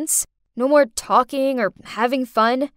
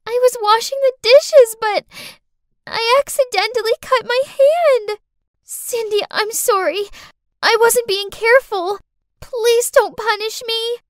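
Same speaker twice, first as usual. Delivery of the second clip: put-on much higher and louder, a tearful little girl pleading; crying child; 12 semitones higher, 6 dB louder than usual. Girl falsely crying to her dad after cutting her hand, higher and louder. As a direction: beg not to be punished, near tears. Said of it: "saw Clarice crying".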